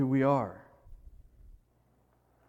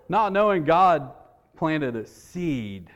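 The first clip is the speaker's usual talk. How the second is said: ordinary talk, nothing marked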